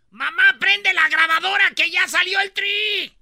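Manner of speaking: high, loud, slow excited yell; excited fan shout. Retold or imitated. A fan calling to his mom, high loud excited yell. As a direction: yell it like a thrilled kid.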